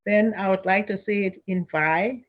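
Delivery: normal talking voice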